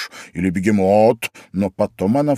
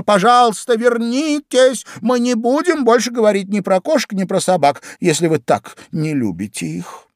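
put-on high-pitched girlish begging; exclaimed plea; 11 semitones higher than usual. A narrator's reading. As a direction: beg someone swimming away to come back. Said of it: "воскликнула Алиса". (высоким голосом умоляет вернуться)

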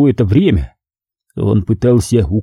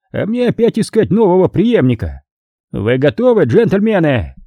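contemptuous cardinal; 6 semitones higher than usual. Acted. Cardinal berating his failed protégé before the execution, high and scornful. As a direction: draw out the contempt, pitched up as the cardinal.

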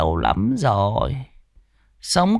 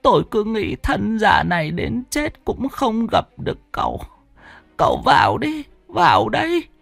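put-on higher, tearful old lady voice; whimpering old woman; 13 semitones higher than usual. A narrator's reading. Old woman leading Trương indoors, higher tearful whimper. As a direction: pull him inside while whimpering. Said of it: "vừa đi vừa mếu máo".

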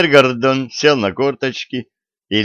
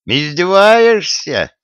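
high, drawn-out indignant question; angry outburst; 9 semitones higher, 4 dB louder, slower than usual. A narrator's reading. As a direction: flare up, stretching the word high. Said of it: "разозлился Гордон". (разозлённо, высоко и протяжно)

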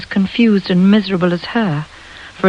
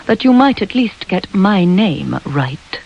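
usual speaking voice

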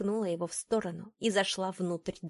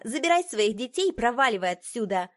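loud, higher-pitched dismissal; angry, raised-voice order; blurted out; 4 semitones higher, 6 dB louder than usual. A narrator's reading. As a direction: throw them out with an outburst. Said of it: "выпалила Зухра".

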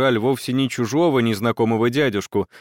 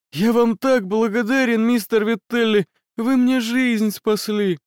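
grateful; 9 semitones higher than usual. Acted. Wounded boy thanking rescuer.

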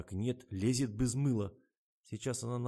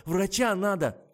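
high, loud alarmed cry; panicked, trembling; 8 semitones higher, 8 dB louder, slower than usual. A narrator's reading. Kira insists on getting a doctor, panicking. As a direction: cry out urgently in fright. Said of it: "дрожащим голосом пояснила Кира".

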